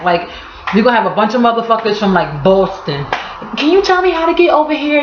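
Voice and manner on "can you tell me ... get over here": high, lost tourist voice; clueless tourist. Retold or imitated. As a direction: play a lost out-of-towner asking directions. Lost Boston tourist asks.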